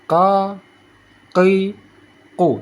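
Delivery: tongue root to rear palate on "Ka, ki, ku"; single-scrape qaf. Shown as a demonstration. Teacher demonstrating placement, "Ka, ki, ku" with single back knocks. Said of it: "Sekali kerok".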